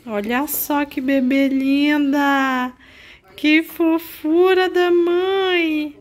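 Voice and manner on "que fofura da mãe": high, slow cooing to a pet. Real, not acted. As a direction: coo it like talking to a kitten. Mother coos to her cat. (voz de bebê carinhosa)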